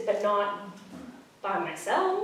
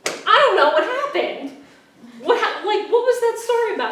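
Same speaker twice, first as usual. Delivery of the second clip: much higher and louder, laughing through bewildered confusion; baffled outburst; 12 semitones higher, 10 dB louder than usual. Retold or imitated. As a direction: blurt out total confusion, then laugh at it. Baffled after the counseling video.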